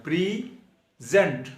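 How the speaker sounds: Present with the first syllable pray hit hard, slow and drawn out; stress on PRE. Shown as a demonstration. Teacher drilling the class, slow heavy stress on pray. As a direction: lean heavily on pray in present and stretch the word. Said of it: "P R E के ऊपर stress देनी है आपने, ज़्यादा जोर देना है".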